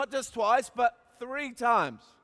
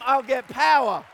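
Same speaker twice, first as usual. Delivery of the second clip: ordinary talk, nothing marked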